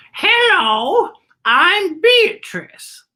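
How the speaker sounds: put-on very high, slow, sweet old lady voice; a kindly woman greeting at the door; Beatrice's voice. A narrator's reading. Teacher reading aloud, voicing Beatrice at her door.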